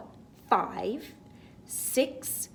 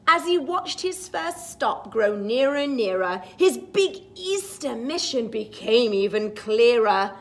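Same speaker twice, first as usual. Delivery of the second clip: rising, excited read-aloud, higher on moon; storybook reading; 6 semitones higher, 8 dB louder than usual. Acted. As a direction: build the rhyme up to the moon. Reads the picture book rhyme.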